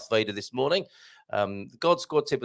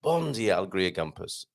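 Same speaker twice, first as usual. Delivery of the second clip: "Bon dia", then "Alegria Gumpers" dropping lower and softer; unhurried redo; 5 semitones lower, 4 dB quieter than usual. Real, not acted. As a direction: say the greeting properly, taking your time. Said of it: "Sorry, I rushed that".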